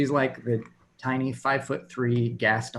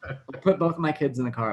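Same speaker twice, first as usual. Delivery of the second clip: usual speaking voice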